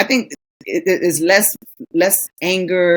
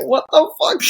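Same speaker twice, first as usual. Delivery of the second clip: higher, bewildered husband; baffled husband; 8 semitones higher than usual. Retold or imitated. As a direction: play the confused man. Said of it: "He's like".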